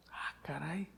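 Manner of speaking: soft breathless whisper; a hushed, amazed aside; awed whisper. Real, not acted.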